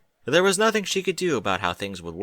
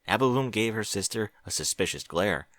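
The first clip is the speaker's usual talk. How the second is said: usual speaking voice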